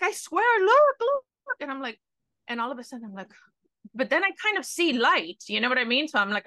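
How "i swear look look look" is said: put-on high, loud taunting kid brother; pushy brother voice. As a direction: yell it like a pestering little brother. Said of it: "He's like".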